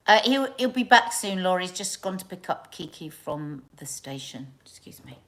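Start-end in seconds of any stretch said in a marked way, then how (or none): 1.4-5.2 whispered aside off the main chat; quick whisper to someone nearby. Real, not acted. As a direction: drop to a hushed aside so viewers barely hear. Hushed aside while live on air.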